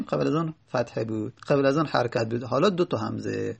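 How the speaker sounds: ordinary delivery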